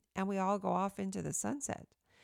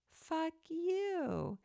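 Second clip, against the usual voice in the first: much higher, sugary and doting, like cooing to a pet; sweetest loving voice; 9 semitones higher than usual. Shown as a demonstration. Speaking to her dog in the sweetest, most loving voice. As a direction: coo it so the dog hears only love. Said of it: "In the sweetest, most loving voice".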